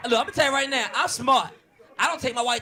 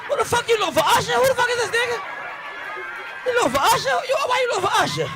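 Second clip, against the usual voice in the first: much higher, louder disbelieving yell; incredulous outburst; 5 dB louder than usual. Retold or imitated. Cop reacting to partner's description, incredulous shriek.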